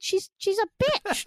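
high, slow mock outrage; drawn-out childish indignation, retold for laughs; appalled verdict. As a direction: land the final word like a shocking discovery. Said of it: "go in and read it like that".

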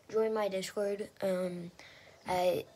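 ordinary delivery

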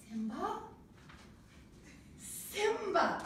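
"Simba?" asked with a rise, then "Simba." landing high with a fall; ask, then recognize him; questioning then recognizing. Shown as a demonstration. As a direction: director demo of the name, doubt then recognition. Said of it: "Like, do this".

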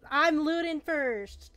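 loud, slow, stretched-out call; excited shout. Real, not acted. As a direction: claim the loot loudly, drawing it out. Rushing to loot the body.

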